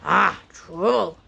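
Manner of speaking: solemn Scottish warrior, drawn out. A narrator's reading. Spoon faces the creatures, solemn. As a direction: say it gravely, taking your time. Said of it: "said Spoon solemnly".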